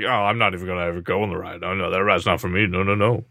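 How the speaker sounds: low, quick, dismissive imitation; mock refusal. Host throwing co-host's refusal back at him, mockingly.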